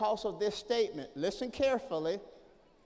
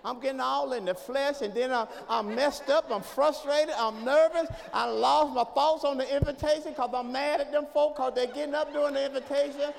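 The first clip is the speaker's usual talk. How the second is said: fired-up preaching; 3 semitones higher, 5 dB louder than usual. Acted. Preaching at full force.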